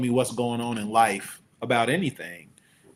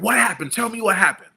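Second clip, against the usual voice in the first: higher, louder barked commands; barking orders; 9 semitones higher, 7 dB louder than usual. Retold or imitated. Officer demanding the driver's story, barked loud and high. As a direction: bark it like a cop who owns the scene. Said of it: "Like, barking orders".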